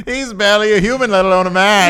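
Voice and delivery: carnival barker. Pretend. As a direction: roast him like a showman. Barker mocking a wrestler.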